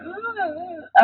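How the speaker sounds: high mock wail; imitated crying. Daughter showing the crying she heard, imitated wail. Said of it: "and I hear".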